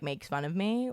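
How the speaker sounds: normal talking voice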